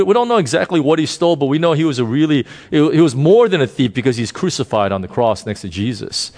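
normal talking voice

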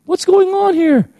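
very high, loud, playful call; high mock-scolding hey; Holy Spirit nudging. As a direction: catch a friend red-handed, teasing.

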